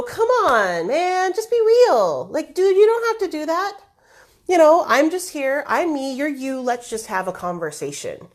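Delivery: a host imitating someone begging a show-off to talk normally; exasperated plea. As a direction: come on, just be real with me. Pleading with a big-word user.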